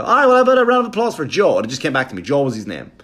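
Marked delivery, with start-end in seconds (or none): 0.0-1.6 high, loud, fast showbiz emcee; a squeaky, rushed MC; mock emcee voice. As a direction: pump up the room like a cheesy host. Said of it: "and I go".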